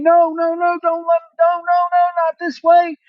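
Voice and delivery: high, pleading no no no; panicked pleading. Retold or imitated. Wrestler watching his title slip, pleading high. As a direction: beg him not to let it happen. Trick's panicked reaction voiced.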